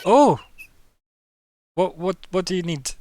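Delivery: high, loud flustered question; confused and tense. Acted. Shopkeeper flustered by the strange customer, tense and high. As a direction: caught off guard, tense. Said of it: "Looks confused and tense".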